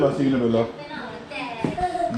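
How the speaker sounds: high, slow, tender farewell; sweet goodbye. Real, not acted. Saying bye in a sweet voice.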